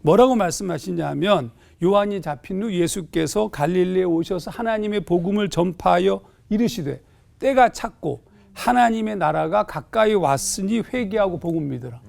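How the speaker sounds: normal talking voice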